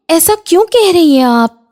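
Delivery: high-pitched dismayed question; hurt protest. Acted. Bahu criticised over massage, high hurt protest.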